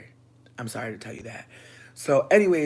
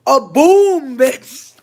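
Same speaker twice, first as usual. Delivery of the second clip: loud telling; 8 semitones higher, 8 dB louder, much slower than usual. Retold or imitated. Describing the door banging.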